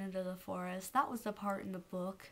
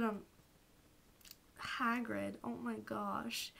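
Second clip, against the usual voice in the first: ordinary talk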